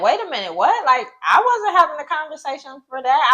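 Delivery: high-pitched, taken-aback objection; startled protest. Retold or imitated. Daughter retells objecting to mother's suggestion, startled protest. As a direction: pull mother up short in disbelief.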